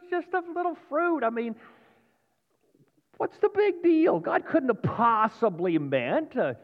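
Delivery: high-pitched, incredulous doubting voice; coaxing tempter. Acted.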